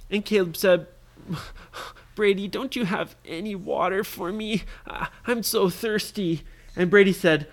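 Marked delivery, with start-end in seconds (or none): 2.1-6.4 put-on higher-pitched, pleading sick child; sick boy. Sick Caleb asks Brady for water, weak and pleading. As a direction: plead weakly for a drink. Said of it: "he was still not feeling good".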